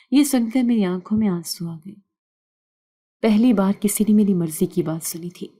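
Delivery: ordinary delivery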